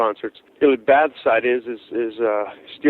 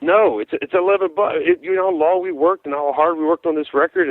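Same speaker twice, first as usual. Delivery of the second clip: higher, mock exasperated yell; retold yelling; 6 semitones higher than usual. Musician yelling at kid over stolen record, retold outburst. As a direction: act out losing it at the fan. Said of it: "like, literally yelling at this kid".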